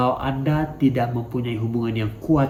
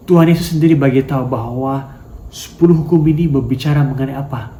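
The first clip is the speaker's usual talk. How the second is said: louder, driving sermon delivery; forceful preaching; 5 dB louder than usual. Acted. Preacher pushes the church toward loving God, loud.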